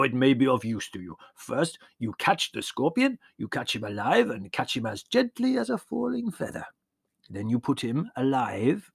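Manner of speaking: put-on warm old peasant shepherd, proud of his trick; old Yanni voice. A narrator's reading. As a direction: voice the old shepherd showing the boy. Yanni explains scorpion oil.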